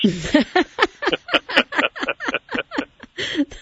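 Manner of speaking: high, bubbling burst of laughter; real laugh. Real, not acted. Host cracking up at the drowned petunias, high bright laughter.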